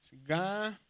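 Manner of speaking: GA-LI-LA-IA broken up by long pauses; each syllable of Ga-li-la-ia held and set apart. Shown as a demonstration. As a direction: sound out "Ga-li-la-ia" slowly for learners. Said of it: "por eso aparece así".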